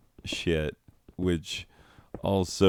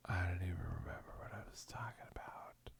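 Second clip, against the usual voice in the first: fast breathless whisper to self; 18 dB quieter, much faster than usual. Real, not acted. Lost the thread, fast whisper to self. Losing track mid-story.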